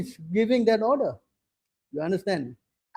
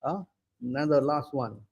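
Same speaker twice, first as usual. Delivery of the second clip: usual speaking voice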